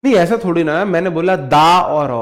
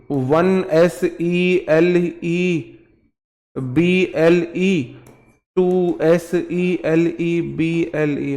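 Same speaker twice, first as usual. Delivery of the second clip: letters slowly, one by one, imitating the student; slow spelled quote; much slower than usual. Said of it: "sir जी आपने पिछली class में पढ़ाया था". (छात्र की नकल में धीरे-धीरे स्पेलिंग)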